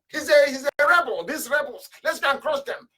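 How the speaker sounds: high-pitched mimicry of accusers; mock alarm. Mimicking Ojukwu's opponents calling to crush rebels, mock alarm. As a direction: imitate them rallying, voice pitched right up.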